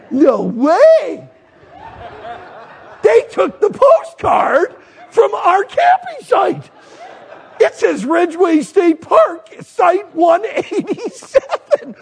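high, loud, drawn-out disbelief; astonished. Retold or imitated. Husband discovering the postcard shows their campsite, astonished. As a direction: exclaim it high, stretching the words.

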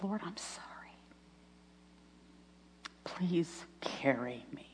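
soft, unvoiced-sounding whisper of repentance; broken whispered prayer. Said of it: "I just broke".

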